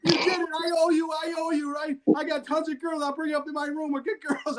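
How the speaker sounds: high, excited celebratory yell; gleeful yelling. Retold or imitated. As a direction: holler happily at the winning fighter. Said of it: "so I'm yelling, having fun".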